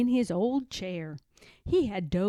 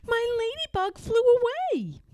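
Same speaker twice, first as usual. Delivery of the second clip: high cub voice; a little bear losing his ladybug; sad little bear; 5 dB louder than usual. A narrator's reading. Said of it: "said Little Bear".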